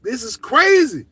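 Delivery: amazed shout. Retold or imitated. Learning old head has the Lala, amazed exclaim. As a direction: let it burst out in disbelief. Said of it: "I was like".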